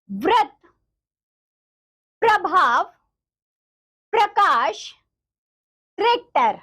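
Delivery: each word read out separately with pauses; clear reading aloud. Shown as a demonstration. Teacher reads each word clearly for the children. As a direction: say every word crisply for the class to repeat. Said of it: "शब्दों का उच्चारण कीजिए".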